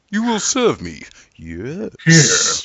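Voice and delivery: villain commanding a minion, pitch swooping high; Megatron puppet. Pretend. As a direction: play a hammed-up robot overlord. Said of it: "having a little Megatron".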